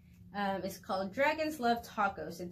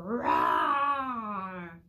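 loud playful roar; shouted pretend roar; boy's roar; 5 dB louder than usual. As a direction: roar like a kid pretending to be a beast. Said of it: "y le gruñó a Theodore".

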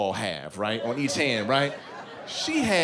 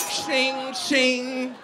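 knife-scraping noise; 9 semitones higher than usual. Retold or imitated. Knives rubbed together.

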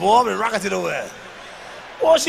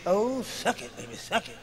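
mock bedroom moans; 6 dB quieter than usual. Mock-praying sinner caught by God.

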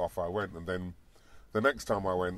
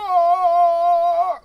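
very high, full-volume jungle yell; soaring loud Tarzan cry; 16 dB louder than usual. Retold or imitated. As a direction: let rip a Tarzan call. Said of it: "like Tarzan".

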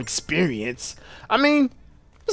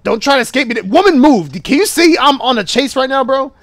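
shouting; 7 semitones higher, 5 dB louder than usual. Real, not acted. Chasing the kitten.

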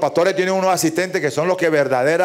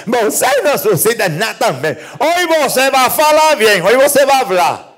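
high and loud, rising into a shouted promise; preacher's healing cry; 8 semitones higher, 5 dB louder than usual. Acted. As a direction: yell the miracle like a faith healer. Pastor promete milagro. (pastor gritando el milagro con acento portugués)

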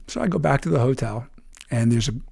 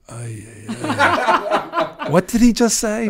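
laughing, high and loud in confusion; overwhelmed laughing ay ay; baffled laughter; 11 semitones higher, 7 dB louder, much slower than usual. Retold or imitated. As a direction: giggle at words you don't get.

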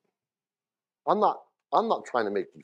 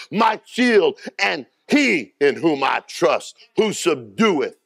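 fervent psalm reading; 4 semitones higher, slower than usual. Acted. Reads David's psalm with fervour.

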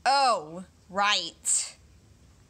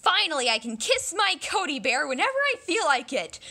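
normal talking voice

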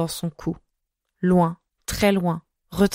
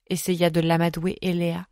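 ordinary delivery

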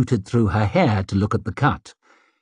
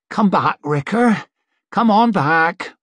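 gentle coaxing; 7 semitones higher than usual. Acted. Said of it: "ever so softly she spoke".

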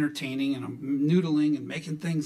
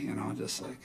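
ordinary talk

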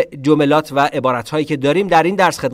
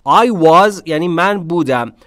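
ordinary talk, nothing marked

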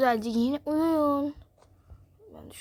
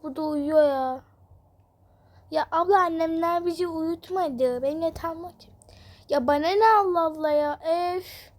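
put-on higher, louder squabbling children; bickering kid sisters; 4 semitones higher, 4 dB louder than usual. Little sister and older sister squabbling over sleeping, whiny kids. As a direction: whine and snap like sisters fighting over a bed.

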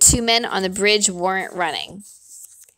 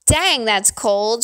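ordinary delivery, nothing marked

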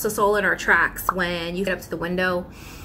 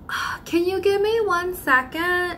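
indecisive customer; 11 semitones higher, slower than usual. Acted.

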